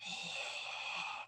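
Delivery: long, throaty open-mouth exhale; mirror-fogging exhale. Shown as a demonstration. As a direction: fog the mirror with one long breath. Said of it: "put a mirror in front of your face and go".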